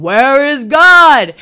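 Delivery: very loud, drawn-out bellow; preacher bellowing the question a third time at the boys. Retold or imitated. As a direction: bellow it with everything you've got. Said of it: "bellowed it out again".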